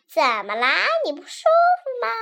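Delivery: high, loud, quick mocking question; teasing, laughing. Lily teases the dizzy witch, high, fast and loud. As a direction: taunt the dizzy witch playfully. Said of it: "莉莉笑着说".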